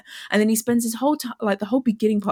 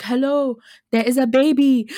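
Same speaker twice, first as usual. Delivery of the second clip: light Austrian accent impression; a hint of Arnold's Austrian accent, slightly louder; casual Austrian-accented action-star voice; 3 semitones higher, 5 dB louder than usual. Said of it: "in his Austrian accent".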